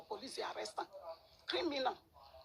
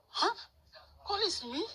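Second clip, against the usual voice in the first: shocked disbelief; 10 semitones higher, 5 dB louder, slower than usual. Retold or imitated. Nancy shocked at arrest.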